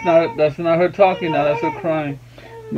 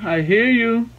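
higher-pitched cooing acknowledgement; 8 semitones higher, slower than usual. Real, not acted. Daddy tells babbling baby he listens, high coo. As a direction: reassure an infant in a raised coo.

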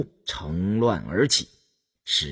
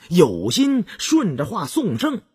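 usual speaking voice

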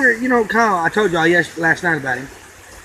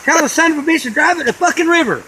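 higher, hammed-up ranting dad; mock angry father; 7 semitones higher than usual. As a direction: rant at the son like a fed-up father. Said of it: "he's just like".

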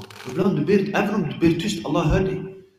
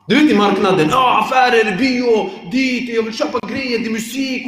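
loud, high, excited rattle of attractions; excited listing; 7 semitones higher, 5 dB louder than usual. Acted. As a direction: get carried away by the bustle, loud and high. Excited list of market distractions.

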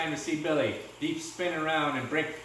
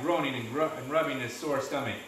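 ordinary delivery

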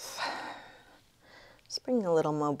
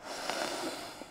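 soft, voiceless sigh; soft exhale; 11 dB quieter than usual. Real, not acted. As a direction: breathe out quietly into the twist. Breathing out in a twist.

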